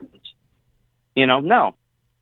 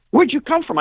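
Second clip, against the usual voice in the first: high, louder mock-startled bodyguard; startled buddy; 12 semitones higher, 5 dB louder than usual. Buddy confronts him after the talk, higher and louder. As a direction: pitch it up like a buddy caught off guard. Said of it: "He said".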